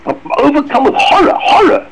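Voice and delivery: rising, the second "horror" cried out high; acted horror. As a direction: voice breaking on the repeated word. Dramatizing the psalmist's terror.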